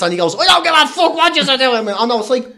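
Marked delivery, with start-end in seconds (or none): 0.4-1.9 high, loud dismissive outburst; acted fury. Comedian voicing man told of TV prank, mock furious. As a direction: imitate a furious man brushing off excuses. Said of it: "He was fucking furious".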